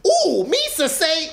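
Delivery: high, slow goofy alien voice; squeaky bumbling Gungan; Jar Jar impression. Retelling the movie, imitating Jar Jar in a high squeaky voice.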